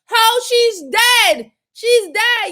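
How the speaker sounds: loud, high heated shouting; loud yelling. Real, not acted. Yelling at the suspect again, loud and heated.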